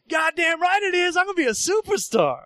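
high, triumphant boast; cocky glee. Retold or imitated. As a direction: crow like a future star. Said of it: "And dude's like".

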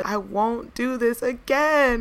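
mock pleading prayer. Hungover plea to God.

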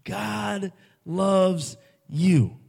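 God loves you drawn out slowly, loves leaned on; clear, slow declaration. Acted. Preacher to congregation, slow and emphatic. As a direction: lay each word down slowly and firmly. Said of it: "I want to say this to you clearly".

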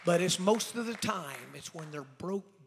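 loud preaching that slows at the end; loud sermon line, slowing to a heavy finish; full-force preaching, slowing down. Acted. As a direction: hammer it home, slowing down. Preaching climax.

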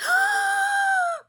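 loud, long, high gasp; raspy-voice exercise. Shown as a demonstration. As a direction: demonstrate a throat-straining exercise with a big noisy breath. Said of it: "I used to do exercises".

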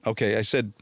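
ordinary talk, nothing marked

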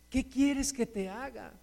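hushed, searching question; gentle asking. Acted. Jesus asking the listener what they want, soft asking. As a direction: let the question land softly.

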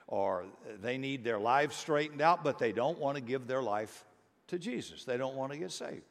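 normal talking voice

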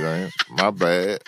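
ordinary delivery

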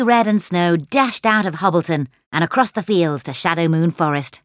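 ordinary delivery

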